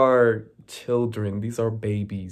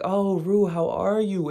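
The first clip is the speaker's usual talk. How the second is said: higher, sugary fake-nice greeting; fake politeness; 8 semitones higher than usual. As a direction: play nice while something is clearly wrong. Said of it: "those little fake conversations".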